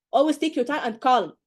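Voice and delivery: ordinary talk, nothing marked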